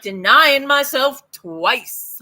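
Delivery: ordinary talk, nothing marked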